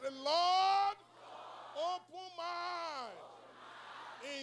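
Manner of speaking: high, drawn-out prayer cry; declaring. Shown as a demonstration. Preacher leading final decree over families, crying it out. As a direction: cry it high, stretching the last words slowly. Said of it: "decree and declare over your life".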